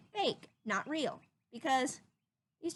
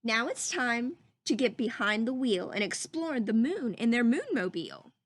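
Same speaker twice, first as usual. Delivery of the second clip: put-on lively storybook reading for children; read-aloud voice; 5 dB louder than usual. Teacher reading a story to her class, lively read-aloud. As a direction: read it like a picture book to the class.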